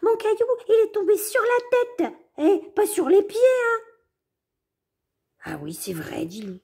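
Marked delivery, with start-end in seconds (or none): 0.0-3.8 very high, squeaky and louder; cheeky mouse. Acted. Mouse corrects the wolf, very high and squeaky. 5.4-6.2 soft unvoiced concession; whispered. Wolf concedes to Mouse in a whisper.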